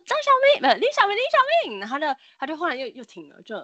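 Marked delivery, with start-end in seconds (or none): 0.1-1.8 loud, much higher urgent shout; calling out a name. Retold or imitated. Classmates shouting the boy's name to wake him, urgent yelling. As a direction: yell his name to snap him out. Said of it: "他们就一直大喊他".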